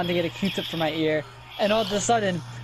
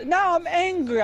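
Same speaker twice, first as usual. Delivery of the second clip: high, mock-angry little kid; mock angry child; 8 semitones higher, slower than usual. Retelling the child's defiance to roommates, mock angry. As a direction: imitate a small child announcing a temper. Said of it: "So he got mad".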